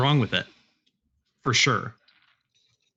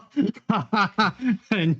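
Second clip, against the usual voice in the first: higher-pitched burst of laughter; real laugh; 10 semitones higher than usual. Real, not acted. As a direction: laugh out at the dice result. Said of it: "I'm laughing at the result".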